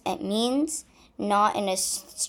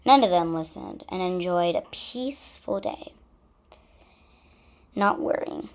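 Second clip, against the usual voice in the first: plain reading voice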